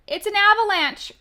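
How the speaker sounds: high, loud cry of alarm. Acted. Toad crying out as stones roll down. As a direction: shout the warning high and loud. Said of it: "cried Toad".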